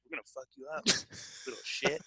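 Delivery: mock con man threatening under his breath; quiet threat. Con men in a cab threatening a boy, spoken with quiet menace. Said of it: "drove me around and were like".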